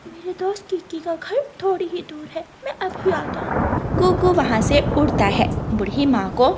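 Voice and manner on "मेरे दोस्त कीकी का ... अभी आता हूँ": eager little bird, pitched higher; a kind child helping an old mother; lightly voiced little bird offering help; young bird Kuku.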